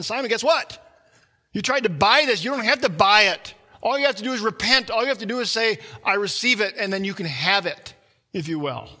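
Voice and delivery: preacher crying out an apostle's plea; preaching Peter. Acted. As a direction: Peter pleading hard with Simon to repent. Preacher voices Peter to Simon.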